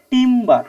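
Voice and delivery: "Timber" drawn out on টিম, then বার; split at the syllable. Shown as a demonstration. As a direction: hold the first syllable of "timber" before the second. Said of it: "বলার পর একটা pause আসছে". ("Timber" টিম-বার, প্রথম ভাগ টেনে)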